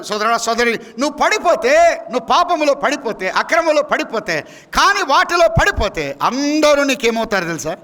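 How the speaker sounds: high, urgent pulpit appeal; insistent, building phrases pitched high; impassioned preaching. Real, not acted. Preaching directly at the hearers.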